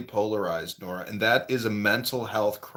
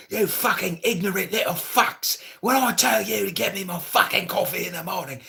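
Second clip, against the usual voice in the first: high-pitched, irate English accent, imitated; angry London Englishman; 10 semitones higher than usual. English guy lambasting Filipino restaurant workers, angry London accent. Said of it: "this English guy from London or whatever was lambasting".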